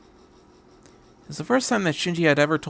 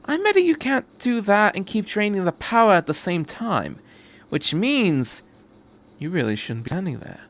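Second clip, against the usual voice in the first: a higher, lightly voiced Doctor, cocky; 8 semitones higher than usual. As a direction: play the clever Doctor, teasing the angel.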